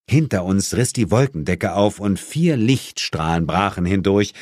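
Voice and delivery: ordinary delivery, nothing marked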